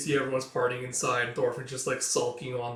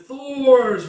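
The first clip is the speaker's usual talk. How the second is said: high, loud drawn-out exclamation; sudden recollection; 12 semitones higher, 13 dB louder than usual. Acted. Chief recalling the forgotten father's name, loud exclamation. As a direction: hit the name as it comes back.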